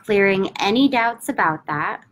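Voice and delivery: slow, calm affirmation with long pauses; measured, soothing repetition; slow guided affirmation. Acted. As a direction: lead them through the tapping mantra gently. Tapping affirmation script, long pauses.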